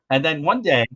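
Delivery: normal talking voice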